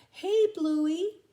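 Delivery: loud, high call across. Acted. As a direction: hail the kangaroo from afar. Calling to kangaroo.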